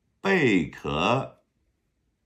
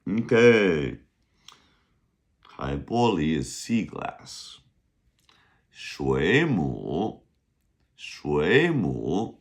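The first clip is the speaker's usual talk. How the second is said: normal talking voice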